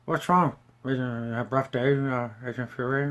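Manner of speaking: mumbled flat line reading; mock a lazy, flat delivery; bad acting. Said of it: "she delivered the line like crap".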